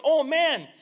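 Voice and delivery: high, excited and relieved; celebrate, then slow down hoping; joyful townsperson, softening to hope; relieved cheering. Acted. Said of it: "people began celebrating and cheering, going".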